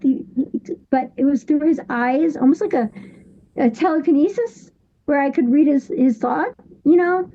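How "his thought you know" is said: usual speaking voice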